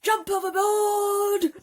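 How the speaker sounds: high, loud, slow drawn-out yell; frantic shout; urgent shout. Acted. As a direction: bellow the order in panic. Panicked crew shouting.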